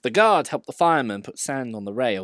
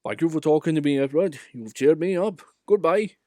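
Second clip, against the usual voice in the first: high, louder thankful voice; grateful old engine; 6 semitones higher, 6 dB louder than usual. A narrator's reading. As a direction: sound cheered up.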